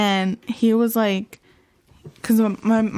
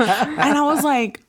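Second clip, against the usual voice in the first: laughing; 5 semitones higher, 3 dB louder, slower than usual. Real, not acted. Laughing at brother's sacrifice theory.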